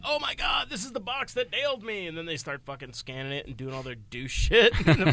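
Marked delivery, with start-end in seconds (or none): 0.0-2.1 high-pitched gullible exclamation; put-on high, breathless amazement; mock excited discovery. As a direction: squeal it like a thrilled dupe. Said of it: "And you're like".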